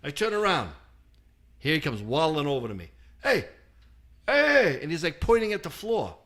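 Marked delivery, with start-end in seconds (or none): 3.2-4.8 high, loud mock Italian "hey, hey"; little Italian voice. The Italian painter pointing at the floor, high and loud. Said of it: "this little Italian voice".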